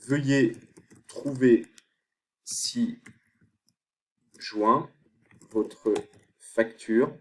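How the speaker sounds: halting, one word at a time; long pauses between words, as if typing them; dictating while typing. Real, not acted. Dictating email body while typing.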